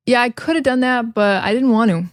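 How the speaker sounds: "could have" and "want to" run together; relaxed American reductions, "want to" with no T; casual reduction. Shown as a demonstration. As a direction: blur "could have" into one word. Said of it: "a lot of times we just say".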